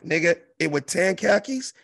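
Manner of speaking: ordinary delivery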